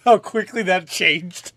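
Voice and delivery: loud, slow, laughing; amused disbelief. Real, not acted. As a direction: drawl it out, cracking up. Laughing at sudden danger.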